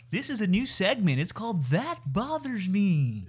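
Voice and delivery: high, swooping announcer voice; radio host impression. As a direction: announce it like a cheesy segment host. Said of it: "That's what they sound like".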